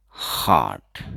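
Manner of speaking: हार्ट said as "haat", no r sound; हार्ट with the r dropped, short clear vowel; silent r demo. Shown as a demonstration. Teacher showing students हार्ट with silent r. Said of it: "तो आर हमेशा silent रहेगा".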